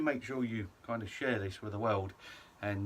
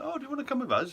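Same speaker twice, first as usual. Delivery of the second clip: much higher, louder sing-song invitation; inviting official; 4 dB louder, faster than usual. Retold or imitated. Staff inviting the travellers aside, high sing-song. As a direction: sweetly invite them along, pitched way up. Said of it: "And they're like".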